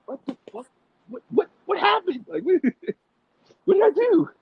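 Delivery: high-pitched, bewildered squeak; mock-bewildered, voice jumping way up; baffled disbelief. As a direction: replay your confusion in a comically high voice. Acting out his baffled reaction.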